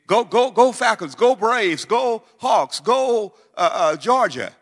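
loud, high cheer for the home teams; fan cheering. Pretend. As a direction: whoop it up like a fan in the stands. Mock sports fan cheering.

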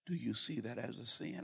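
light, gentle question. Shown as a demonstration. Pastor asking the waiter lightly, not condescending. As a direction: ask it lightly, not heavy. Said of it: "I said it light".

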